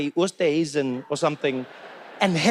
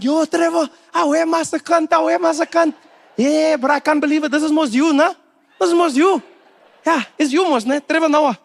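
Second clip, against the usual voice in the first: loud, high-pitched South African fan; eager, high local-guy voice; excited street fan; 10 semitones higher, 6 dB louder than usual. Acted. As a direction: accost a celebrity in disbelief.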